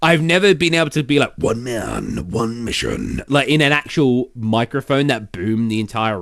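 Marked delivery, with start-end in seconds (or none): 1.4-3.2 low movie-trailer style character voice; voice-acting character voice. Shown as a demonstration. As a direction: drop the voice deep for a dramatic tagline. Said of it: "I do voices as well".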